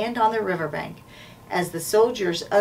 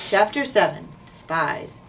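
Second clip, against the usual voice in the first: usual speaking voice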